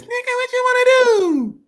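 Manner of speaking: squeaky high helium voice. Pretend. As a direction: talk like you just sucked helium. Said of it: "he got so much hot air and helium".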